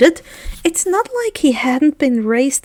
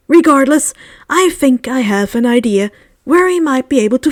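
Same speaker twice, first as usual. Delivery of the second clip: louder, fond grandfatherly headmaster reading; old headmaster's letter; 6 dB louder than usual. Acted. Dumbledore writes to Harry about his date, louder kindly headmaster voice. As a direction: read it as a kindly old wizard writing to his boy.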